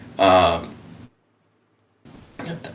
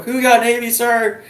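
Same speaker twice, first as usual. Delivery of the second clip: meek high retort; 14 semitones higher than usual. Retold or imitated. His meek retort to the general.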